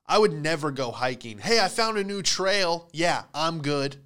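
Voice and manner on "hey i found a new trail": eager friend. Retold or imitated. Friend voiced inviting hiking.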